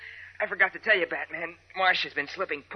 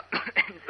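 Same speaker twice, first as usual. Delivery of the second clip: smoky cough. Acted. Smoke filling the room.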